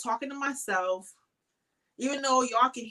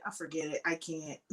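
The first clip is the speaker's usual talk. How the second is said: mock giving up; 7 semitones lower, 9 dB quieter than usual. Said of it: "And I said".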